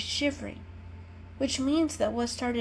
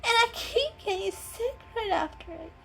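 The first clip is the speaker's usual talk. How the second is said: tearful sick kitten. A narrator's reading. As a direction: whimper it out, miserable.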